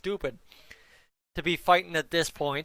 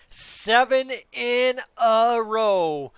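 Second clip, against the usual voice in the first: slow, loud and higher, each word leaned on; big emphasis; 5 semitones higher, 5 dB louder, much slower than usual. Real, not acted. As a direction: stretch it out to hype the streak. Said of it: "I hope I said that with enough emphasis".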